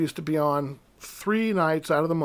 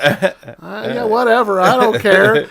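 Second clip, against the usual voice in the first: louder, laughing "whatever, I don't care"; laughing dismissal; 4 semitones higher, 8 dB louder, slower than usual. Retold or imitated. Teen brushing off friends about Aerosmith tickets, laughing. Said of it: "I was like".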